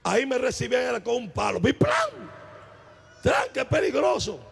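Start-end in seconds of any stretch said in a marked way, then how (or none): 1.6-2.1 a sharp, very high thwack sound; a cartoon hit with a stick; a sudden smack noise, shouted; mock thwack. Imitates blow of a stick.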